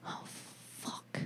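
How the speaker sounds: hushed, breathless whisper to himself; whispered aside. Retold or imitated. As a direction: whisper it in shock, under the breath. Whispered reaction to athlete's confession.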